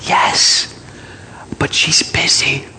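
put-on slow, hushed child's whisper; small whisper. Child covering for mother on phone, whispering. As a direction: whisper secretively as a little kid. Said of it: "Still whispering, the voice said".

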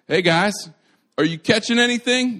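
loud, high, mock-innocent call; high singsong sarcastic shout to the guys. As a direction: call out with teasing, knowing cheer. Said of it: "if you don't think the Bible's sarcastic".

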